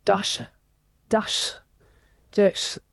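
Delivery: ordinary talk, nothing marked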